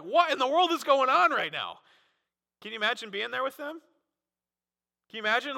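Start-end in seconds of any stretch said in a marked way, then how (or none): 0.0-1.8 high, loud mock bewilderment; amazed outburst, higher and louder; stunned bystanders. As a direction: play the baffled onlookers. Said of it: "they're like".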